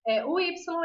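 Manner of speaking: ordinary talk, nothing marked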